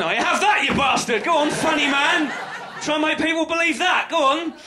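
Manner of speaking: high, loud shout at the sky; mock furious shout. Comic shouting at the universe for its sign, loud and high. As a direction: yell up at the universe, half laughing.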